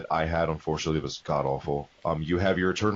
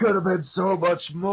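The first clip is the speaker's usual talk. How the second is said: mock lament; 12 semitones higher, 4 dB louder than usual. Lamenting as Miyamoto character.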